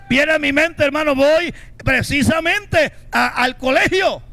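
fired-up preacher voice; preaching. Acted. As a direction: drive the message home to the congregation. Preaching at full force.

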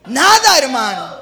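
loud, high preacher's shout, drawn out; stretched, shouted sermon exclamation; full-force preaching. Real, not acted. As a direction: thunder it out to the congregation.

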